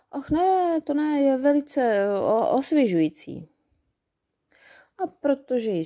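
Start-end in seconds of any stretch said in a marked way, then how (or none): 0.1-3.5 fake brave fairy. A narrator's reading. Fairy pretending water is fine.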